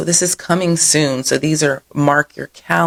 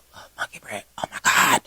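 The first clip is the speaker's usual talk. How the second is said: breathy whisper of longing; whispered craving; 7 dB quieter, faster than usual. Real, not acted. Vlogger whispering about a treat she loves.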